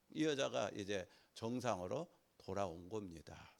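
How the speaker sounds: ordinary delivery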